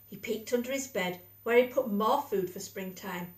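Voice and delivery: plain reading voice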